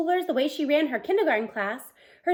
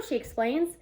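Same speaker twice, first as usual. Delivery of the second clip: usual speaking voice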